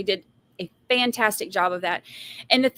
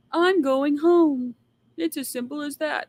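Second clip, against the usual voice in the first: mocking Thami; 5 semitones higher than usual. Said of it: "He will go free if he is".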